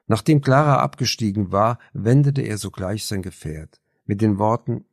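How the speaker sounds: usual speaking voice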